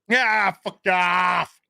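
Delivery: slow, drawn-out mock jeer from fans; mock fan yell. Vlogger imitating angry fans' slow mocking jeer. Imagined fans rejecting Luke.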